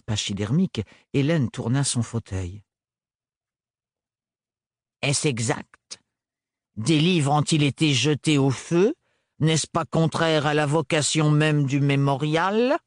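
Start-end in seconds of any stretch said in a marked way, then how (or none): 5.0-12.8 slow, cold, mineral resonance; stony resonant voice. A narrator's reading. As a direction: speak like living marble. Hélène questions. (voix minérale et résonnante)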